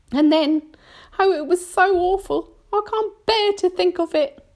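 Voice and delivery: high voice, overcome by the memory; distressed Miss Spider. A narrator's reading. Miss Spider cannot bear remembering her grandmother, high and distressed. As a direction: lament it in a high creature voice.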